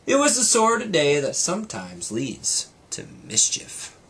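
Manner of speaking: lively read-aloud storytelling voice; brighter, fuller storybook reading; story narration. A narrator's reading. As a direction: read it like a bedtime story to a child. Reads story to child.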